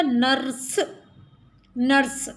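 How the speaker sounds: नर्स as one syllable, nurse; नर्स with र closing the syllable before स; correct reading. Shown as a demonstration. Teacher giving the right word, one joined syllable. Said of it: "सही शब्द क्या होगा इसका?".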